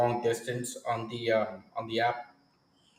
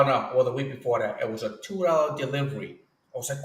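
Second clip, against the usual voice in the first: ordinary talk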